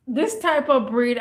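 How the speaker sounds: normal talking voice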